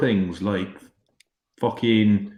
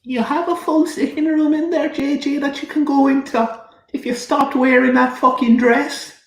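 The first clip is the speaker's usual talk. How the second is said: nagging wife voice; 12 semitones higher than usual. Pretend. Mocking J J in mum voice.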